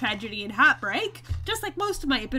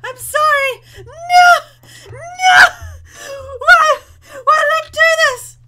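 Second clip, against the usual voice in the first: panicked shouting; 15 dB louder, slower than usual. Real, not acted. Panicked at angering the bees.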